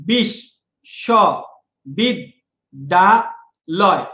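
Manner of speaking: বিশ্ববিদ্যালয় said very slowly with a breath on each of five syllables. Shown as a demonstration. Teacher breathes out each syllable to count them, very slowly. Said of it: "পাঁচবার আমি নিঃশ্বাস নিচ্ছি".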